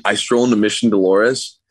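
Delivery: ordinary talk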